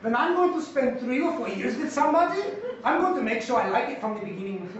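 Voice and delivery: put-on Austrian accent, hammed up; terrible Austrian accent. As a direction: quote an animated colleague in a bad Austrian accent. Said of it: "my terrible accent".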